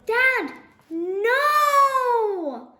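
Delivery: high, loud frantic yell. A narrator's reading. Lizzie yelling as dad jumps off the table. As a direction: scream it to stop him. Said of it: "yelled Lizzie".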